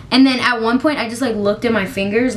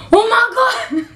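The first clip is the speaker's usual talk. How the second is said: much higher, a laughing cry of alarm; laughing shout; 12 semitones higher, 3 dB louder than usual. Retold or imitated. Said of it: "I was like".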